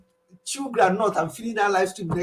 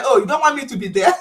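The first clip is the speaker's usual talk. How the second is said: mock offended; 5 semitones higher, 7 dB louder than usual. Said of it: "He said".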